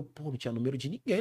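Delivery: usual speaking voice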